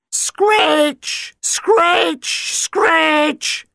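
very high, piercing screech; a shrieking violin screech done with the voice; fiddle screech. Acted. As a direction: imitate a badly played fiddle.

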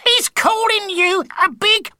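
very high, loud squawk; squawking wife. A narrator's reading. Mrs Tibblethwaite squawking to Monster Mash, very high and loud. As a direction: squawk it out in a panic. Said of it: "squawked Missus Tibblethwaite".

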